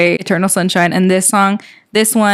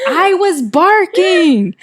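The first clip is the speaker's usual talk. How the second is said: high, drawn-out excited retelling; excited exclamation; 10 semitones higher, slower than usual. Retold or imitated. Telling co-host how she reacted to getting the job, excited. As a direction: relive the wild excitement, stretching it out high.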